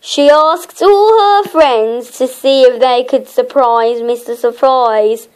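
ordinary delivery

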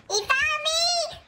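high-pitched, drawn-out shriek; mock-horror wail at being caught; panicked squeal. Real, not acted. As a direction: squeal it out as the monster finds you. Killer finds them in game.

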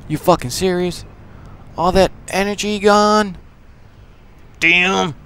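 high-pitched, put-on outrage; mad voice. Gamer reacting to all that energy gone, playful mad voice. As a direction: protest in high, indignant disbelief. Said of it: "That's my mad voice".

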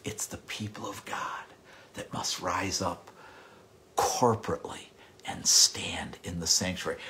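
soft urgent hush; earnest whisper. Acted. Preacher urging the people to rise up, intense whisper. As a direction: whisper it like a secret charge to the church. Hushed call to congregation.

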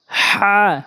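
slow, drawn-out letter names for learners; careful tajweed letter drill; Quran letter recitation. Shown as a demonstration. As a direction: pronounce each Arabic letter carefully. Teacher recites Arabic letter names.

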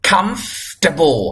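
"comfortable" slowly as "KUMFF-tuh-bo"; correct pronunciation. Shown as a demonstration. Teacher repeating the correction for students, slow "comfortable". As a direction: stretch "comfortable" at the f, drop the "or". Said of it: "ไม่เอาเสียงว่า".

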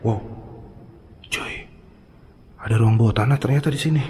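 ordinary talk

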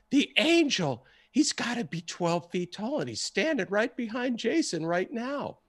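high-pitched voice of an amazed witness; awestruck retelling. Retold or imitated. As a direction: gasp it out high, marvelling at an angel. Acting out man seeing an angel.

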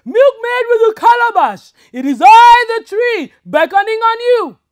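high, loud mock spirit call; talking tree voice. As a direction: boom like a mysterious tree. Tree voice again.

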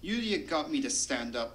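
ordinary delivery, nothing marked

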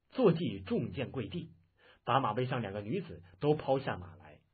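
plain reading voice

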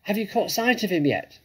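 high, loud called question; calling back. A narrator's reading. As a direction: call it across from a distance. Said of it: "Bean called back".

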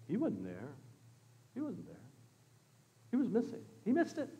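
very soft refrain with pauses; hushed aside. Real, not acted. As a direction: drop right down, as if confiding it.

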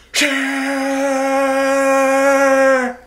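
long, high drawn-out scream; screamed curse. Real, not acted. End of a bad-day rant, screaming it out.

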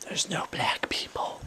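hushed unvoiced whisper. Real, not acted. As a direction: whisper it like a secret. Whispered aside.

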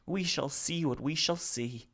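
Salo piecing his words together; speaking haltingly. Acted. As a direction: Salo confessing to himself. Salo's voice.

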